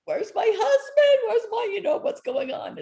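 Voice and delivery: high, loud, frantic cry for her husband; panicked wife. Retold or imitated. Wife at the airport panicking about her husband's flight, high frantic cry. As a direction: shriek it like a wife losing hope. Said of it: "I was just kinda like".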